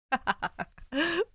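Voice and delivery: short amused laugh. A narrator's reading. Dick laughing at Danny, short laugh. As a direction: break into a laugh. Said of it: "Dick laughs".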